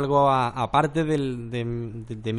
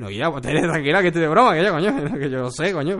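laughing backpedal; 4 semitones higher, 6 dB louder than usual. Retold or imitated.

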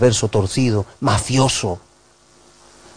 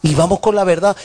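ordinary talk, nothing marked

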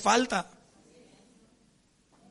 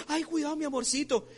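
higher-pitched, sugary doting voice; mock loving coo; 6 semitones higher than usual. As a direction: coo as if to a neighbour's child. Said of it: "Lo trata con un amor". (imitando una voz melosa y cariñosa)

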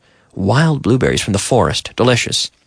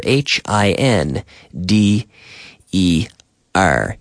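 spelling out; 5 semitones lower, slower than usual. Shown as a demonstration. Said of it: "So there we have the verb to hinder".

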